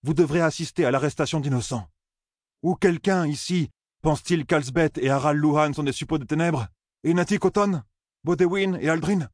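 high-pitched, pressing challenge to a crowd; challenging orator. Acted. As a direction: press the listeners with pointed, high-pitched questions.

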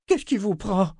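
high, outraged question; indignant wife demanding an answer. Acted. Amy defending her husband, high and indignant. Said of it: "s'indigna Amy".

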